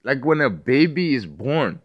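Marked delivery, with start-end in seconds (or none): none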